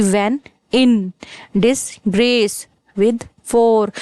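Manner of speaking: "when in disgrace with four" very slowly, beat by beat; iambic meter demo. Shown as a demonstration. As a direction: chop "when in disgrace with four" into separate metrical beats with gaps. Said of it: "means इस तरीके से".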